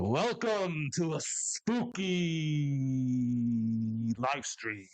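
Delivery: spooky voice. Acted. Spooky Halloween livestream opening.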